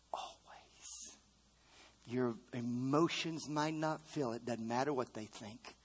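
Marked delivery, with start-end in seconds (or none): 0.1-1.2 breathy whispered always; hushed whisper of always. Acted. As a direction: drop to a bare whisper. Whispered echo of the promise.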